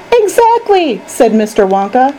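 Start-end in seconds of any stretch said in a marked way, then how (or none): 0.1-1.0 high-pitched, emphatic exclamation; delighted confirmation. A narrator's reading. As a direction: pounce on it in a high voice. Wonka delightedly confirms Charlie's guess.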